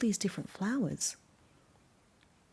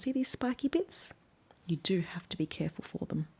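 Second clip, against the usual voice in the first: soft whispered warning; 6 dB quieter than usual. Real, not acted. Reader warning children about spiky thorns, whispered. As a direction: share a secret caution.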